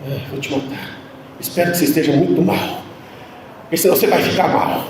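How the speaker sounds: hissed threat under the breath; whispered threat at a sick son in the waiting room; menacing whisper. Retold or imitated. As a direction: whisper it like a silent death threat. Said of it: "com aquela cara assim".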